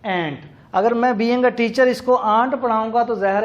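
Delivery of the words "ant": ant said as a short ant sound; pronounced ant. Shown as a demonstration. Teacher models ant for pupils, clear pronunciation. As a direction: model the word ant for the class. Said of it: "बच्चे इसे pronounce करेंगे as".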